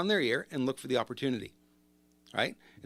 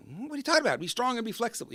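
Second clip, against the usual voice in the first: mock disbelieving retort, pitched much higher; incredulous scoff; 9 semitones higher, faster than usual. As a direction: play the skeptic scoffing at the advice. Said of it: "I know that sounds like".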